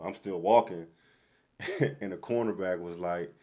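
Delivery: ordinary talk, nothing marked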